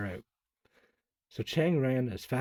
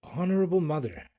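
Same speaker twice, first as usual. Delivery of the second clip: gasping; 6 semitones higher, 4 dB louder than usual. Acted. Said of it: "he gasped".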